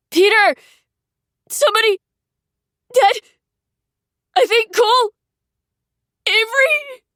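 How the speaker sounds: high, loud cries for help; desperate calling. A narrator's reading. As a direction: call out names in panic. Dying calls in fire.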